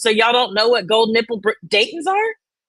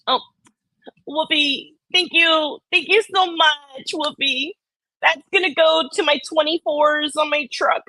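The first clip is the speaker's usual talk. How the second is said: higher-pitched, hammed-up emotional thank-you; mock gushing gratitude; 5 semitones higher than usual. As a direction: parody an overcome streamer thanking a donor. Parody of emotional super chat thanks.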